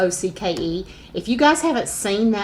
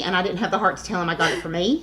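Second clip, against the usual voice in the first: normal talking voice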